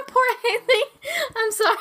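high, loud laughter breaking through the words; can't stop laughing while apologising. Real, not acted. GM laughing at a player's bad notice roll.

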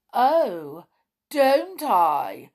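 loud, challenging retort; defiant storybook villain; wicked gnome. A narrator's reading. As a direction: answer back as a nasty little gnome. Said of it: "his little eyes glittering wickedly".

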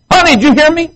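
high, loud whiny nagging wife; hammed-up shrill-voiced wife calling out. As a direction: squeal like a wife demanding attention. Acted marital quarrel, wife nagging.

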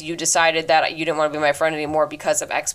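normal talking voice

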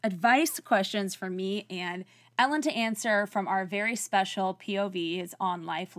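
ordinary talk, nothing marked

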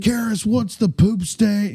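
high, slow monster truck voice; pro wrestling hype. Shown as a demonstration. Caller hyping up the cheering bar crowd, pro wrestling voice. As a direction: draw it out like a wrestling announcer. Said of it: "It's like really pro wrestling".

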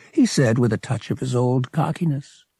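usual speaking voice

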